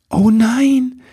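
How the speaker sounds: high, startled oh no; dismayed cry. Acted. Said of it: "rief Ribbelwitsch".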